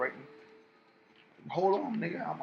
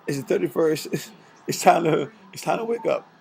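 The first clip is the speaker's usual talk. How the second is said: loud, urgent wake-up announcement; loud wake-up call; 3 semitones higher, 10 dB louder than usual. Real, not acted. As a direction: call it out to rouse them. Waking sleepers, announcing the date.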